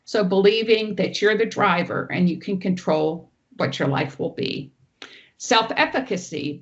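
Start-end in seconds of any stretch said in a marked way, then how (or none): none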